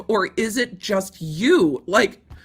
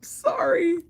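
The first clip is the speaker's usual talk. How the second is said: squeaking very high through helpless laughter; cry-laugh, barely getting words out; laughing fit; 11 semitones higher, much slower than usual. Real, not acted. Cracking up over the lawsuit, a high laughing squeak.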